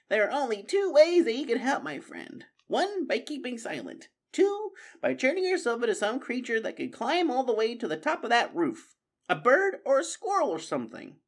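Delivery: an old mouse gently mocking; Methuselah lecturing. Methuselah telling Constance how she could help, dry high voice.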